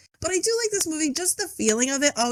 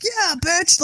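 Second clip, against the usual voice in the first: mock cheer; 6 semitones higher, 4 dB louder than usual. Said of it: "she was like".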